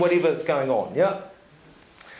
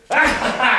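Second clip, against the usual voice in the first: laugh echo; 6 semitones higher, 6 dB louder than usual. Retold or imitated. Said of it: "And he goes".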